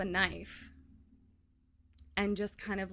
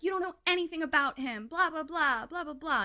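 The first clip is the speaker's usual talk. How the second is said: put-on high, loud, fast teen outburst; heated yelling; 10 semitones higher, 5 dB louder than usual. Daughter yelling at her dad about her boyfriend, high and heated. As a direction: fire it back at dad, voice up high.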